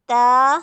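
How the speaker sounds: ता said loud with a light, thin t; ता said cleanly and lightly; ता kept short and thin. Shown as a demonstration. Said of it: "जैसे".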